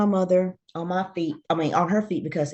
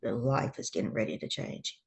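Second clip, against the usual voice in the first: said to herself; 4 semitones lower, 8 dB quieter than usual. Retold or imitated. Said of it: "I said to myself".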